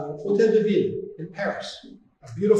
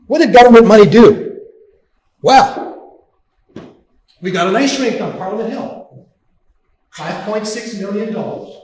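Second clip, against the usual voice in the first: loud, indignant outburst, higher in pitch; outraged; 4 semitones higher, 14 dB louder than usual. Real, not acted. Speaker denouncing government spending, outraged and loud. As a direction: rail against the waste, voice raised. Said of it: "I'm sorry if I'm sounding a little bit outraged".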